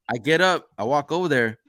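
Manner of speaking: normal talking voice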